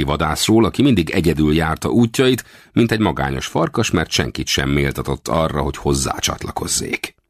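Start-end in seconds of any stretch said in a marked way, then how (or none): none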